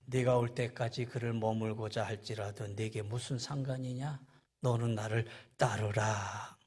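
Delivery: low, soft, solemn pronouncement; quiet, measured authority; dignified Jesus. Acted. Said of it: "점잖게 얘기하죠".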